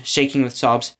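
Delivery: usual speaking voice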